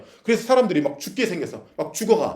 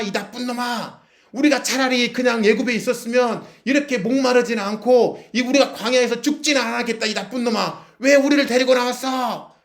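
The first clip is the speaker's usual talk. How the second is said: high-pitched yelling of an aggrieved crowd; acted-out outraged complaint, voice pushed high; angry complaint; 8 semitones higher than usual. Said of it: "막 원망하는 거야".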